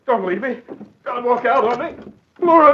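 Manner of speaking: rising panicked plea ending in a cry; desperate pleading. Acted. Husband begging his wife not to leave, desperate. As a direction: beg her not to go.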